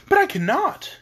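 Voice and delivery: high-pitched startled protest; greatly surprised. A narrator's reading. Dorothy refusing Oz's order, greatly surprised. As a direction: protest in girlish shock. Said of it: "greatly surprised".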